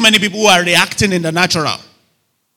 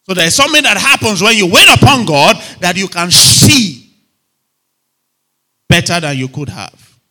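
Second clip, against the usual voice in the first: emphatic preaching; 2 semitones higher, 7 dB louder than usual. Real, not acted. Preacher urging congregation to wait on God, emphatic preaching. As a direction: preach it out loud and raised.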